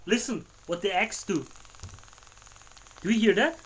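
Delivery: ordinary delivery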